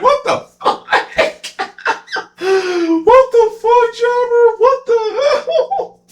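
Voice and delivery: very high shrieking through laughter; mock shriek, cracking up; laughing disbelief. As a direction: cackle out the words in disbelief. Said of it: "they're both laughing".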